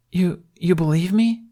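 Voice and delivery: high, stammering disbelief; can't believe his luck; a boy stunned that an adult believes him; surprised. A narrator's reading. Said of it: "he asked".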